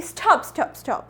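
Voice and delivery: crisp put-on British accent; imitated British English, correcting someone; Hermione Granger. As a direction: lecture like a know-it-all British schoolgirl. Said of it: "Hermione Granger की तरह".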